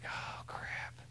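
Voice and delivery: soft unvoiced whisper of dismay; whispered dread. Fiancé hearing bad news on the phone, whispered dismay. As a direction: breathe it out, sinking feeling. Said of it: "And I was like".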